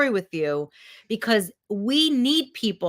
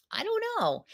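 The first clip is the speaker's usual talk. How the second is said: light, high, soft; high shrug; 11 semitones higher, 5 dB quieter than usual. Real, not acted. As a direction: toss it off with a high lilt. Playful shrug.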